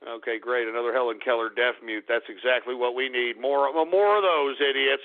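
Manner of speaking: low, unimpressed deadpan; sarcastic grumble. Real, not acted. Sarcastic gripe about silent caller.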